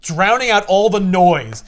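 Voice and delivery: ordinary delivery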